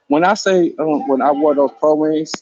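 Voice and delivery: normal talking voice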